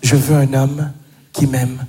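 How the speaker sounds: low, measured, direct statement; hyper direct. Acted. A woman describing her ideal man, hyper direct. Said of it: "vous êtes hyper direct".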